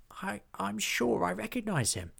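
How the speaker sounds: high, stammering goblin voice; unsure, squeaky recognition; Hobgob. A narrator's reading. Hobgob replies, voice pitched high and hesitant. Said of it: "replied Hobgob".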